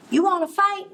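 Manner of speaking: high, pushy challenge; high-pitched cocky bug; picking a fight. A narrator's reading. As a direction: squeak out a cocky dare. Grouchy ladybug picks a fight.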